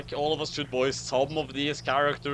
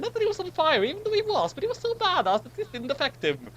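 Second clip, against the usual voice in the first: much higher and fast; chipmunk-like pitch, rushing; a squeaky narrator aside; faster than usual. Acted.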